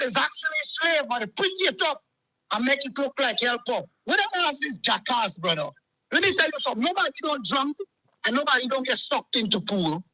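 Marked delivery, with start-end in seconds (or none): none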